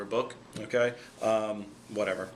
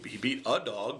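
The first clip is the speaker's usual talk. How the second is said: normal talking voice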